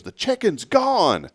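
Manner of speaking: high, slow squeal of alarm; imitating wife. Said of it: "She goes".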